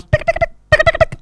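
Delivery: quick soft tongue clicks; rapid ticking sound effect; web-tying ticks. Acted. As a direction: tick away softly as the web winds. Imitating web being tied.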